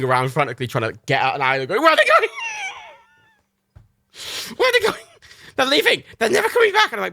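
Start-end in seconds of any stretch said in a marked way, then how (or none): 1.8-6.9 very high, loud, frantic squeal; panicking dog voice. Retold or imitated. Dog Matilda panicking as her owners leave, squealing very high and loud. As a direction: wail it like an abandoned puppy. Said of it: "my impressions of Reagan and Matilda".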